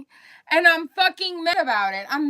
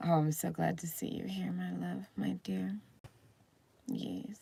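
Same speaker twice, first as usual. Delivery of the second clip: unvoiced, hushed greeting; soft whisper; 7 semitones lower, 18 dB quieter than usual. Real, not acted. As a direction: breathe the words tenderly to the chat. Greeting chat softly, whispered.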